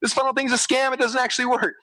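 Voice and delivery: hammed-up whimper of a let-down client; mock crying complaint. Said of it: "I'm gonna get a box from you crying".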